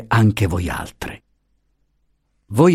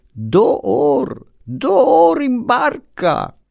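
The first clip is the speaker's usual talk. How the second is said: put-on feeble old woman; much slower than usual. Deaf wife's stock phrase.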